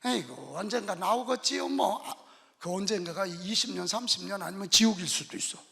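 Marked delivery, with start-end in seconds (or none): none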